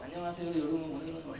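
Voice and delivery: soft, low, uninvested reading; mock book-reading. Preacher mimics himself without love, soft low mock reading. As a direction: imitate a teacher just reading off the page. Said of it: "그냥 책 읽죠".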